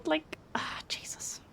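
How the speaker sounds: breathy, nearly voiceless sigh of words; exasperated whisper. Real, not acted. Streamer reacting to chat, exasperated whisper. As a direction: let it drop to a whispered exhale.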